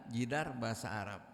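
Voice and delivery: ordinary talk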